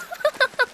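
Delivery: high scornful burst of laughter; mocking laugh. [name] laughs at Hugh for bringing marbles, mocking. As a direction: laugh to belittle him.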